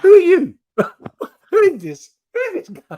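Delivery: very high-pitched, bewildered squawk; incredulous outburst. Acted. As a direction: react in mock disbelief at a stranger. Acted baffled reaction to his accent.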